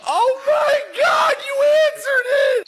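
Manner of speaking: high, loud shocked disbelief; shocked shout. Real, not acted. Dad shocked at pet answer.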